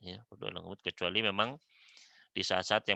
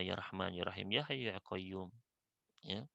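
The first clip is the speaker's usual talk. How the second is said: ordinary talk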